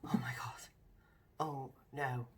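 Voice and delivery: a retold whisper of sudden panic; panicked whisper. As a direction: breathe it out in a frightened hush. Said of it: "and I was like".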